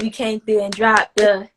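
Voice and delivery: usual speaking voice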